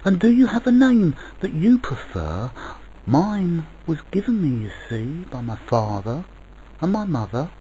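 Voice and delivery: put-on high, eager child voice, easing as it explains; child asking. A narrator's reading. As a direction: chatter earnestly like a curious child. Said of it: "asked the child of dreams".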